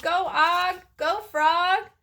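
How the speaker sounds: high cheering yell. A narrator's reading. Hamster urging on his frog mount, yelling. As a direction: cheer him on like a rodeo rider. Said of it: "I yelled".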